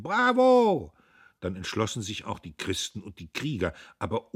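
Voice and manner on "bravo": loud, high cheer; the suppliers shout approval; high, loud bravo; crowd cheering. A narrator's reading. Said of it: "Die Lieferanten zuerst riefen".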